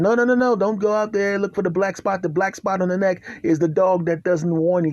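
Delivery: no no no, stop them going out; frantic warning, voice jumping up; urgent warning. Retold or imitated. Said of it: "And I said".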